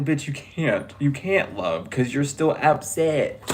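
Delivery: usual speaking voice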